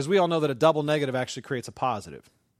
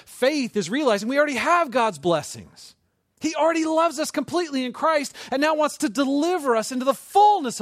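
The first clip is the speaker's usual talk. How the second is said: high, loud, fervent proclamation; impassioned preaching; 9 semitones higher, 6 dB louder than usual. Real, not acted. Preacher proclaiming God's blessings already given, fervent. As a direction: proclaim it with soaring force.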